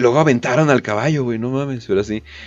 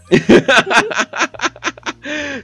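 high burst of laughter; 13 semitones higher than usual. Real, not acted. Laughs at the Coca-Cola prize joke.

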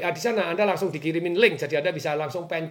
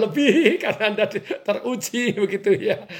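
laughing; 4 semitones higher, 4 dB louder than usual. Real, not acted. Jokes about fasting viewers' temptation.

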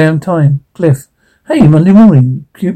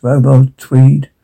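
normal talking voice

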